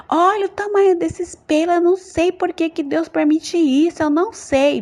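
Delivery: high, exasperated complaint; angry griping. Retold or imitated. Woman shaving in a dream, quarrelling with God, angry. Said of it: "tirando assim com raiva".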